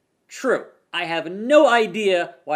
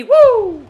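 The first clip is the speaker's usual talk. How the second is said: high, loud wrestling woo; shrill showman's woo; Flair woo yell; 13 dB louder than usual. Pretend. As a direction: let out a ring-style woo.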